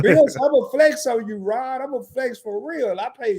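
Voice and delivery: high-pitched, teasing one-upmanship; playful bragging. Real, not acted. Traveler topping a friend's cheap-flight story, playful bragging.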